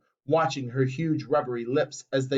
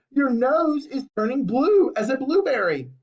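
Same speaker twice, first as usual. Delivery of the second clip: alarmed shout; 8 semitones higher than usual. A narrator's reading. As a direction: keep shrieking in alarm. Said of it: "screamed Missus Beauregard".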